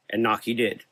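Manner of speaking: plain reading voice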